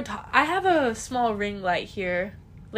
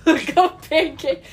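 laughing; 5 semitones higher, 8 dB louder, much slower than usual. Pretend. Echoing radio code, laughing.

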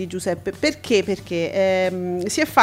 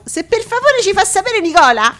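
pleading call; 9 semitones higher, 6 dB louder than usual. Pretend. Begging absent colleague Nicola.

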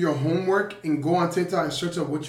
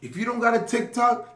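hype speech; 4 semitones higher, 10 dB louder than usual. Acted. Loud hype advice to listeners.

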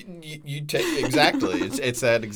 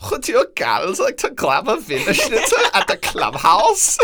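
prim German friend voice; 8 semitones higher, 4 dB louder than usual. Acted. Podcast host voicing Elizabeth.

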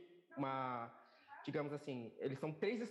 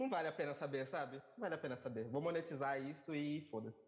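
ordinary delivery

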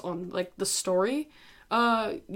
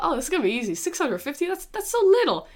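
naive confidence; 7 semitones higher, 4 dB louder than usual. Retold or imitated. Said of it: "I thought".

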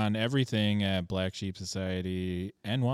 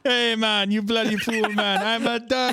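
put-on high, loud Jamaican-accented duck; mock Jamaican accent; 9 semitones higher, 5 dB louder than usual. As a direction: do a goofy Jamaican accent, pitched up. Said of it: "Why did you kinda go Jamaican".

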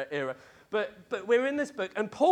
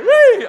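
high, loud mock "whee" squeal; playful squeal; 21 dB louder than usual. Preacher joking about being burned at the stake, playful squeal. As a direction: let out a shrill, playful whee. Joking he'll be a human torch.

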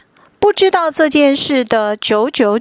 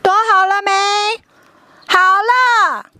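high loud hide-and-seek call; 8 semitones higher, 5 dB louder than usual. Acted. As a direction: call across the field.

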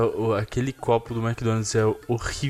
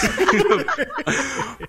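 high laugh. Real, not acted. Laughing at the review.

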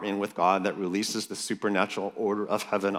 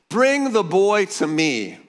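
high, loud, slow lightly voiced command; commanding Jesus; 10 semitones higher, 7 dB louder, slower than usual. Preacher voicing Jesus summoning the boy, commanding. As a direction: call for the boy with authority.

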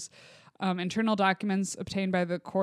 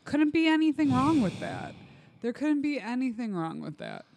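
sarcastic; 5 semitones higher than usual. Acted. Mock reassurance about pesticide crops.

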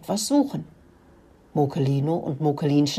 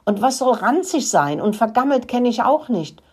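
higher-pitched, mildly irritated question; growing annoyance; 6 semitones higher than usual. A narrator's reading. Rat Rudi resents the mouse's lecture, mildly annoyed. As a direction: let irritation creep in, pitched up. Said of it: "wurde langsam ärgerlich".

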